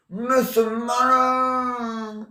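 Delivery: high, slow drawn-out scream; a woman crying out, high and stretched. Acted. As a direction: wail it out high and long. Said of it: "la femme qui crie".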